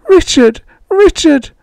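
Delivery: high, loud desperate cry; shrieking, crying. A narrator's reading. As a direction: shriek his name in anguish. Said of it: "Shrieking, crying".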